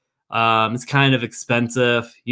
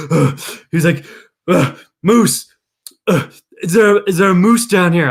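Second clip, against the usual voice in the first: high, panting mock tourist voice, a bit louder; out of breath; 7 semitones higher, 5 dB louder than usual. Winded tourist in Cowboys gear asking the guide, mock panting. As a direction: puff out each word like a sweaty hiker. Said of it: "He was out of breath".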